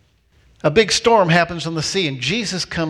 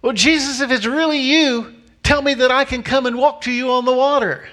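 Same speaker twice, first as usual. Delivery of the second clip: raised pitch, calling out a challenge; lightly voiced Peter; 8 semitones higher than usual. Peter asking Jesus to let him walk on water, high-pitched appeal. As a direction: put Peter's plea a good deal higher. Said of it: "And Peter says".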